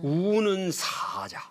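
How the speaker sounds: slow whispered phrase. Acted. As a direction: breathe it out slowly. (천천히 속삭이듯)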